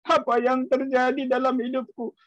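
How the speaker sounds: high, drawn-out wailing prayer; mock crying. Believer crying to God over a small problem, mock wailing. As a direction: imitate a whiny tearful cry to God. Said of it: "sedikit ada masalah nangis".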